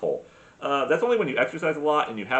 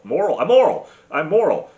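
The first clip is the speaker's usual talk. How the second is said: mock proud insistence; 4 semitones higher, 9 dB louder than usual. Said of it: "I'm ethical".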